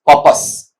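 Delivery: पर्पस breathed without voice, pəpəs; teacher whispering पर्पस as the model; whispered model word. Shown as a demonstration. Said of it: "इस तरीके से आएगा".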